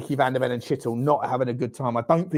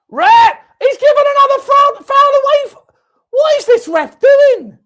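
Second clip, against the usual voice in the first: much higher, much louder outraged yelling at the referee; furious shout at the ref; 13 dB louder, slower than usual. Real, not acted. Fan screaming at the referee over a foul call, furious shout. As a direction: bellow at the ref like he's robbed you.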